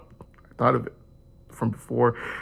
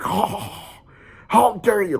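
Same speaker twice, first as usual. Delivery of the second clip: higher, hammed-up indignant cry; mock outrage; 10 semitones higher, much slower than usual. The father at a parked car, mock outraged. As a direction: play the offended maniac. Voicing the angry father at mosque.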